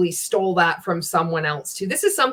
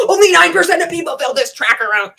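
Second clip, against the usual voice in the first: higher, louder mocking rant; mock angry rant; 10 semitones higher, 8 dB louder than usual. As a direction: imitate the boss losing his temper. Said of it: "like, so fucking mad".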